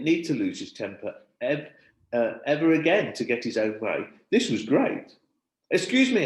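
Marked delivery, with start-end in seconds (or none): 5.7-6.2 calling for attention. A narrator's reading. Teacher calling class attention.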